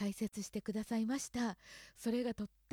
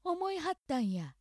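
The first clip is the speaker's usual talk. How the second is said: 思いはったんや in Osaka accent; 大阪アクセントで思いはったんや; 4 semitones higher, 3 dB louder than usual. Shown as a demonstration. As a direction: demo 思いはったんや Osaka style. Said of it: "大阪アクセント、".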